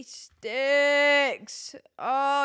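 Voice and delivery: loud, long-held wail on the word; dismayed outburst. Real, not acted. Player realising they brought no sticks, loud drawn-out dismay. Dismay at forgotten game item.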